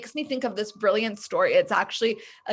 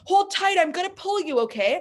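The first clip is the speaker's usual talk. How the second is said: higher and louder, urgent call to a friend below; 9 semitones higher, 4 dB louder than usual. Retold or imitated. Kid calling down into the hole to his friend, higher and louder. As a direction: shout down encouragement to someone trapped.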